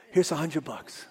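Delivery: hushed, low-voiced confidence; retold whisper. Preacher secretly handing over money before the service, hushed. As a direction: say it under your breath. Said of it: "I whispered to Phil".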